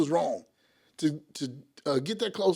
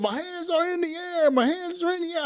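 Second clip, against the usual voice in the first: high-pitched, stretched-out plea; retold cry of compliance; retold loud call; 11 semitones higher than usual. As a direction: call it out high, as if making sure everyone hears. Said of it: "I said to real loud".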